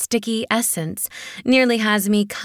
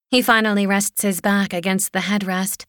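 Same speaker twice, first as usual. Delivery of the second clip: usual speaking voice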